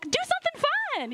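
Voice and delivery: way up high, bubbly nudge; pleading fun. Retold or imitated. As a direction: coax a steady colleague to loosen up. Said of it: "I'm like".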